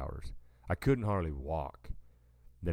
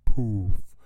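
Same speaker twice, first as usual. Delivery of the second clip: loud burst of a poof, like sand exploding; sudden loud poof imitation; poof sound effect; 4 semitones higher, 12 dB louder than usual. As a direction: punch out a big poof noise.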